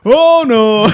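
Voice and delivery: loud, high-pitched mock horror; hammed-up panicked realisation; mock dismay. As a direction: cry out like someone who has blundered in. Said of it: "the look on their face was like".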